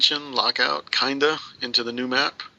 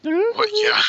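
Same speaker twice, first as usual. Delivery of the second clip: squeaky high voice. Pretend. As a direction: squeal it like a cartoon. Sudden squeaky outburst.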